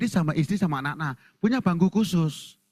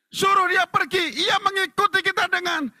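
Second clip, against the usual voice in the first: dramatic scripture reading. Acted. Disciples asking the teacher to send her away, high declaiming. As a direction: declaim the disciples' complaint on a raised pitch. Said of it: "Dengan teriak-teriak".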